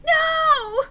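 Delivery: very high, loud hammed-up scream; over-the-top horrified scream. A narrator's reading. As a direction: shriek the word in horror. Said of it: "I screamed".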